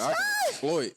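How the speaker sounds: mock hustler line breaking into laughter; laughing through it. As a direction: voice jumps high and cracks with laughter. Acted pickup line, laughing.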